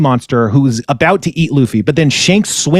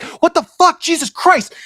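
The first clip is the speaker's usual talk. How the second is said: high, startled what the fuck; shocked outcry. Retold or imitated. Pirates shocked as the kid stabs himself, high outcry. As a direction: squeal out in horror.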